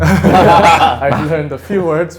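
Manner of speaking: laughing through the words. Real, not acted. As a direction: laugh as you admit it. Laughing while answering.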